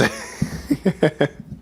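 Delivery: high giggle; laugh. Real, not acted. Streamer after saying what they shouldn't, embarrassed laugh. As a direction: burst into a high, breathy laugh.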